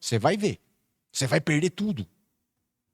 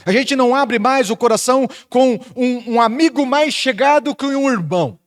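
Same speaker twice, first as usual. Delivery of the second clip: higher and louder, driving each phrase; rally the church against empty religion; preaching at full force; 3 semitones higher, 5 dB louder than usual. Acted. Pastor confronting the congregation about religion, loud preaching.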